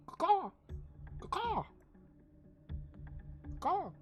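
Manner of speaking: high falling eagle caws; bird caw. Acted. GM voicing the hippogriff cawing at the player. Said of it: "Caw-caws at you".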